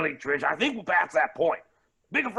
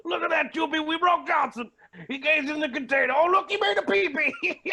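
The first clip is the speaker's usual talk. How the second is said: laughing; 7 semitones higher than usual. Real, not acted. Mocking broken Johnson.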